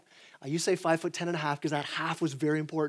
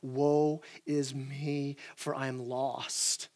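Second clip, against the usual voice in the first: slow, soft, lower lament; despairing lament; 5 semitones lower, 6 dB quieter, much slower than usual. Retold or imitated. Isaiah before God, lamenting slowly and softly. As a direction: drag out each word in ruin. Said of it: "What's he say in verse five?".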